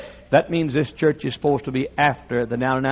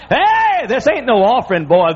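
much higher, louder excited shout; mock Peter bursting with excitement; 8 semitones higher, 5 dB louder than usual. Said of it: "Peter said to John".

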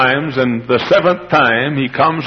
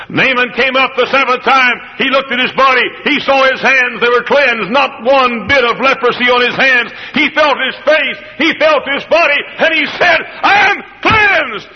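Naaman's voice swelling to a joyful shout; rising joyful cry; 8 semitones higher than usual. Acted. Preacher voicing healed Naaman's joyful shout.

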